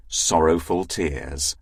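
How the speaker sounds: usual speaking voice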